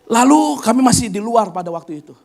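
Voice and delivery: ordinary delivery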